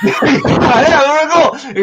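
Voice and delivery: real laugh, high and loud. Real, not acted. Laughing loudly as his friend guesses the Avatar parallel. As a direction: burst out laughing while agreeing.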